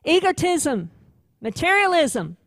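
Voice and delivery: a courtroom accuser building his case, loud and relentless; accusing prosecutor. Acted. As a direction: hammer each charge home before the judge. Preacher voices the accusing prosecutor.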